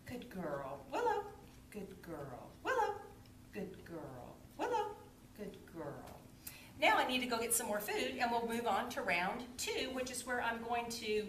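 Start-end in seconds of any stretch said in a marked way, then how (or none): 0.1-5.9 low, soft praise. Real, not acted. Trainer softly praising her puppy.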